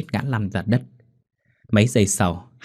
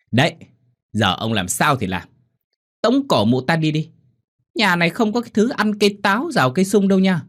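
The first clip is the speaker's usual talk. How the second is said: shrill nagging wife; 9 semitones higher than usual. Acted. Wife urges husband to expel mother.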